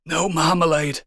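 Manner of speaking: hammed-up final utterance; dying last words. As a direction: act out a postman's last words. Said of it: "in his dying breath, he utters the words".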